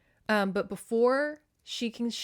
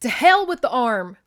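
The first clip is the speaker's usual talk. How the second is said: angry growl; 3 semitones higher, 10 dB louder than usual. Acted. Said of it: "Glenn Morgan growled".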